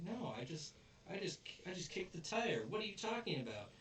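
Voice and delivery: high-pitched, quickening defensive protest; high, indignant mock denial. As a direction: act out an innocent kid protesting. Acted protest of a kid accused.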